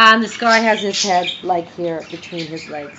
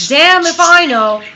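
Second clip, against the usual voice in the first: loud, high punchline exclamation; exasperated outburst; 3 semitones higher, 8 dB louder than usual. Acted. God answering the praying rabbis, mock-exasperated outburst.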